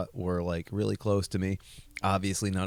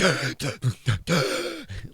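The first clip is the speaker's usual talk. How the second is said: gasping; 6 semitones higher, 4 dB louder than usual. Retold or imitated. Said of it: "as if I was drowning".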